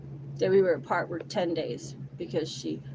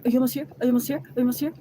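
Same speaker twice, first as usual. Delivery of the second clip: higher, louder repeated nagging; pestering impatience; 4 semitones higher, 5 dB louder, much faster than usual. Retold or imitated. He keeps calling her as she drives, pestering. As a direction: imitate him badgering her by phone. Imitating his repeated calls.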